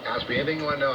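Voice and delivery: ordinary delivery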